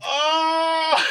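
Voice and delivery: real surprised yelp; surprised shout. Real, not acted. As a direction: jolt out a startled oh. Reacts to funny phrase.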